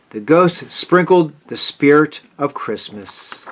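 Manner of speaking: usual speaking voice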